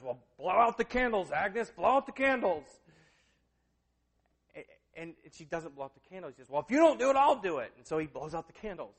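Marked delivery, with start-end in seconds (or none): 0.0-2.7 loud, raised, insistent command; retold holler, stretched out and pushy; impatient urging. Hank orders her to blow. 6.5-7.7 much louder, higher, exasperated; retold shout of impatience; impatient outburst. Hank loses patience.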